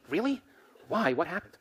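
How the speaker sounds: high-pitched mock interest; curious surprise. Friend asks why someone's in a mood, curious surprise.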